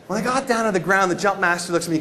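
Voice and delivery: usual speaking voice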